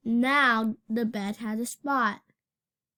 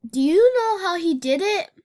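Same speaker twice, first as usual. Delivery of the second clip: excited question; 7 semitones higher, 7 dB louder, faster than usual. A narrator's reading. Narrator builds suspense to child.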